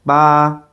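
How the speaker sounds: high level held "ba"; "ba" on one steady high pitch; first tone. Shown as a demonstration. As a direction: say "ba" high and flat, sustained. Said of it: "for the first tone".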